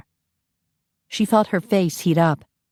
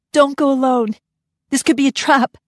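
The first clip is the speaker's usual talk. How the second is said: higher, urgent plea; anxious warning; 9 semitones higher, 3 dB louder than usual. Acted. Mother warning her husband, anxious. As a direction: warn him with fear.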